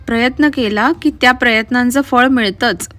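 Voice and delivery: plain reading voice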